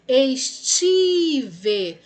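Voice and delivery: ESTIVE with a long, loud TI; stretched stressed syllable. Shown as a demonstration. Teacher tests the word's stress for pupils, stretched stress. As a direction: hold the TI of "estive", then drop off. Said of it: "paroxítona".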